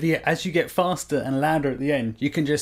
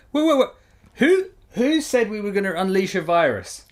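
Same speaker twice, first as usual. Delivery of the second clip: higher, louder startled backtrack; mock alarm; 6 semitones higher, 3 dB louder than usual. [name] rereading the bill, mock startled alarm. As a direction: catch yourself in sudden alarm. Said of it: "looking back at the thing going".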